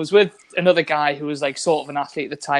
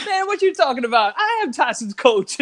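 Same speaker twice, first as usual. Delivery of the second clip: lightly voiced indignant coach; 7 semitones higher than usual. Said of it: "and he's like".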